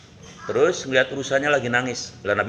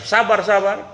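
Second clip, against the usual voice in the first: mocking retort; 6 semitones higher than usual. Hunter mocks the calming word.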